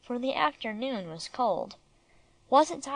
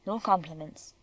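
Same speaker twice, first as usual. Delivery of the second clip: low, curt and gruff; gruffly; 5 semitones lower than usual. Acted. German guard dog refusing a compliment, gruff and low. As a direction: brush the praise off gruffly, lower. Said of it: "he said gruffly".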